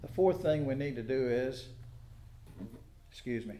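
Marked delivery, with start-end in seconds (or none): none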